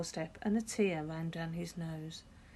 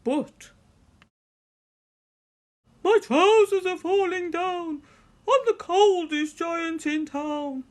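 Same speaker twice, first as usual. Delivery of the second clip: much higher and louder, slowing at the end; dismayed wail; 11 semitones higher, 11 dB louder than usual. A narrator's reading. George wails as his trousers fall down. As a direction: wail it as the song falls apart. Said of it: "felt sad and shivery".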